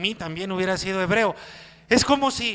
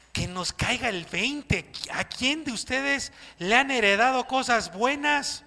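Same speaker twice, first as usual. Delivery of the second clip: usual speaking voice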